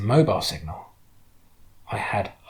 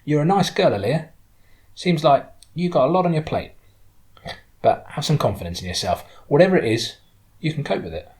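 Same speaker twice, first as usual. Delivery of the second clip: a hint of the friendly driver, mostly higher; lightly voiced woman driver, encouraging; 6 semitones higher, 4 dB louder than usual. Driver encouraging a troubled girl at parting, lightly voiced. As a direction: lightly play her bolstering someone's confidence.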